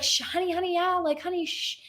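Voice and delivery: put-on calm your overexcited kid down kindly; lightly voiced mom soothing; hushing mom. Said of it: "her mom was like".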